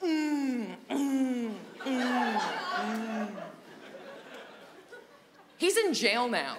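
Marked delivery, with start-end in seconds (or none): 0.0-3.3 series of closed-mouth moans, falling; strained mock moans. Classmate with a handful of sour balls in his mouth, strained moans. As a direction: moan through a mouth crammed with candy.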